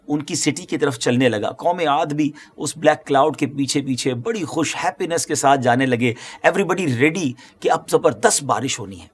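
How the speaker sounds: normal talking voice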